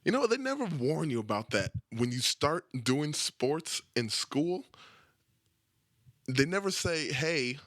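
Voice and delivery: grumbling loudly about aching knees; indignant rant. Real, not acted. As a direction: build up the outrage. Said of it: "rising rant".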